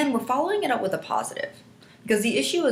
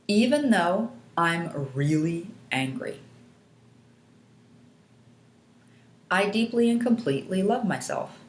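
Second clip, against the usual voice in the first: repeat-after-me statement. Shown as a demonstration. Coach giving clients a setup statement to repeat, measured recitation. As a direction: lead them line by line, unhurried. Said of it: "you're gonna repeat after me".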